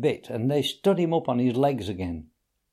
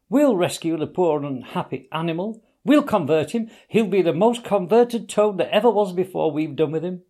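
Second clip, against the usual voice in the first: eager; 6 semitones higher, 3 dB louder than usual. A narrator's reading. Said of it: "cried the Rat, starting up".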